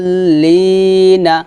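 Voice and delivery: দলিনা with the vowel held very long; long madd. Shown as a demonstration. Teacher demonstrating the long madd pull. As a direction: stretch দ in দলিনা for four alifs. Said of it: "চার আলিফ টান দিয়ে".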